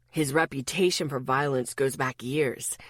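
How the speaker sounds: low, cutting warning; sharp tone. Acted. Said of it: "His voice had a sharpness to it".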